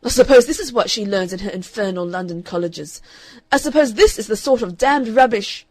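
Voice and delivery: loud, indignant father ranting; a furious father railing at his sister; angry father. Acted. Father fuming to his sister, loud and angry.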